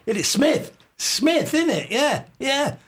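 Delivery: high, pushy fan voice; imitating his tipsy self pressing Mel Smith, high; eager fan. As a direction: insist it's him, pitch up. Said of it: "I said, I said".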